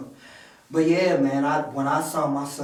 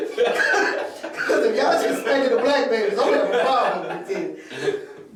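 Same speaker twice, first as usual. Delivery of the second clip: high, laughing through a joking protest; laughing mock complaint; 7 semitones higher, 4 dB louder than usual. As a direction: act offended while cracking up. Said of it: "So I'm like".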